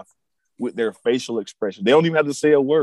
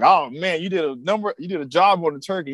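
quoting Clark; 6 semitones higher than usual. Retold or imitated. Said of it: "And he's like".

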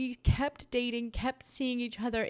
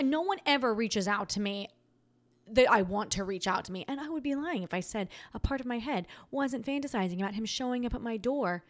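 ordinary talk, nothing marked

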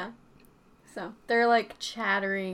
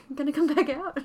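higher-pitched mock calling out; imitated yell; 6 semitones higher, 4 dB louder, much faster than usual. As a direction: lightly act out a fox calling back. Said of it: "like, yelled again".

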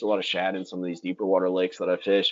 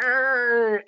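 yell; 4 dB louder than usual. Retold or imitated. Yelling while fighting a hooked fish.

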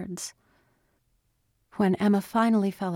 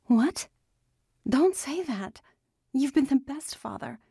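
high-pitched, shocked objection; distressed daughter insisting, much higher; upset protest; 8 semitones higher than usual. Acted. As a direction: protest from the heart in a raised voice. Daughter protests father's self-blame.

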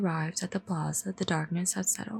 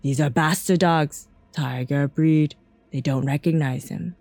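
loud, lower-pitched, commanding tamer; menacing villain proclaiming loudly; exclaiming animal tamer; 4 semitones lower, 10 dB louder than usual. Acted. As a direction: declare it slowly and forcefully. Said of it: "the tamer exclaimed then".

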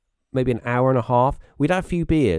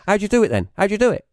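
high, louder retold challenge; accusing; 10 semitones higher, 5 dB louder, faster than usual. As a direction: imitate a rattled mate demanding answers. Mate accuses him of faking.

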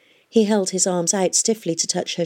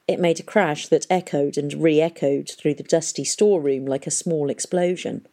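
ordinary delivery, nothing marked

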